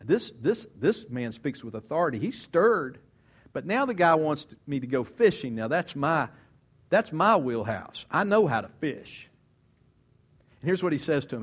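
ordinary talk

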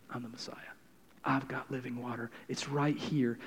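Jesus reassuring the ashamed, softly; hushed reassurance. Acted. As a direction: say it close and gentle.

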